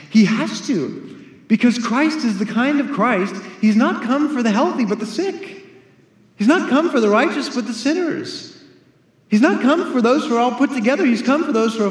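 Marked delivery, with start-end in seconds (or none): none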